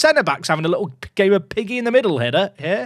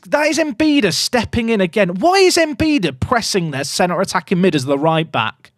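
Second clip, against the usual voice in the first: usual speaking voice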